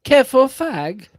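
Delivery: very high, loud put-on voice; mock warning cry. Teasing friends after the teacher story, high loud mock cry. Mock heckle in British-slang joke.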